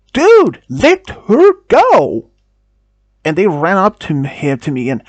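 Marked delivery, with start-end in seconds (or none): 0.1-2.3 much higher and louder, slow shout. Retold or imitated. Friends shout at the man grabbing her arm, loud. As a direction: yell at him to let go.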